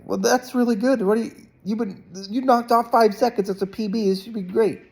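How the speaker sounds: upbeat encouraging dad. Retold or imitated. Dad cheering his disappointed swimmer son, high upbeat praise. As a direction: pump him up like a coach on the sidelines.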